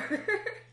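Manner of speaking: squeaky high laugh; high burst of laughter. Real, not acted. Laugh after joke.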